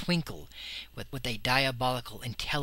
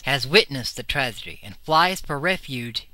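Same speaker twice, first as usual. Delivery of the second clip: usual speaking voice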